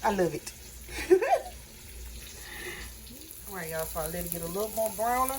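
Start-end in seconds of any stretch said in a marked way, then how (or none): 0.6-3.4 airy laugh. Real, not acted. Laughs about cheap cookie sheet.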